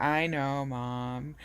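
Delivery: loud, mock-exasperated daughter; eye-rolling exasperation. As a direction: roll your eyes out loud at mom. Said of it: "rolling my eyes".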